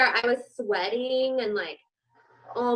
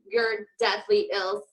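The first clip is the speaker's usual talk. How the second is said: normal talking voice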